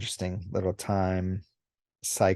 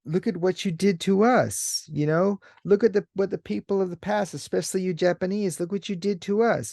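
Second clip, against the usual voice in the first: much higher, fast, reproachful lecture; accusing alien Oni; 9 semitones higher than usual. Retold or imitated. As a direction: throw their history back at them. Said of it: "And he says".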